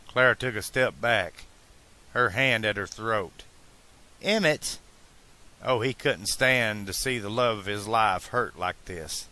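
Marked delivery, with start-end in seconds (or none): 4.2-4.8 high shocked gasp of his name; shocked cry. Acted. Clara hurt by sudden farewell.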